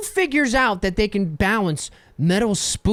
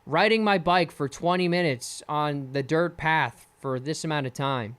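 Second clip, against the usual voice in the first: ordinary delivery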